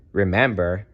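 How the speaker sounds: ordinary delivery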